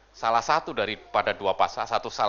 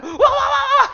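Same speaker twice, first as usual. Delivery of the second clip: imitating fear; 10 dB louder, slower than usual. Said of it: "orang kalau takut".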